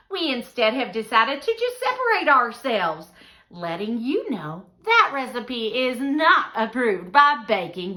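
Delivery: big, high, loud podcast host; Doris Baker. Acted. Baking host welcoming listeners and disowning a guest's recipe, exuberant showy. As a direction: gush to the listeners with theatrical, swooping emphasis.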